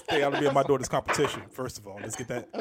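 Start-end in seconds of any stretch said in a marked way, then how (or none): none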